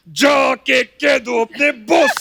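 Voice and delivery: Jeetendra's high, slow angry courtroom shout. Retold or imitated. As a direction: defy the boss with righteous fury. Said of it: "फिर एक angry mood है".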